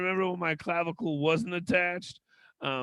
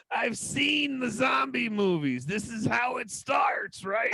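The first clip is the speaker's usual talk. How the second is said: higher, slow, mock-panicked reply; mock alarmed retort; 6 semitones higher, slower than usual. Patient mocking the surgeon, higher and slow. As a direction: play up the zombie fear. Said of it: "And I was like".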